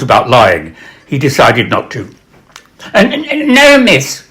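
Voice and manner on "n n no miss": put-on high, stuttering, caught-out boy; boy stammering to the stern art mistress; scared stammer. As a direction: stammer it like a frightened twelve-year-old.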